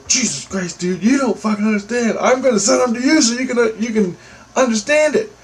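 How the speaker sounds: retold scream, strained up high in exasperation; frustrated screaming. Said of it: "that's why I screamed at him".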